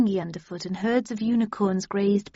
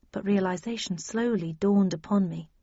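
usual speaking voice

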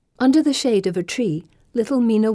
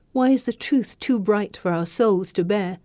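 plain reading voice

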